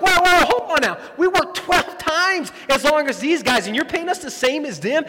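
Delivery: high, loud indignant objection; loud, high "whoa, hold on now" complaint; workers protesting. Retold or imitated. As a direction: push back hard, offended. Twelve-hour workers protest pay.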